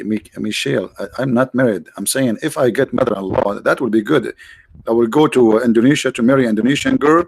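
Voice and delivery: normal talking voice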